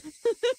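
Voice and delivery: helpless high squeal of a laugh. Real, not acted. Laughs at own meowing.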